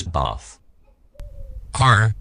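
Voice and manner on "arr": a loud, indignant Arr; angry pirate roar. Acted. Captain interrupted in his bath roars loudly. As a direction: bellow it like a pirate caught in the bath.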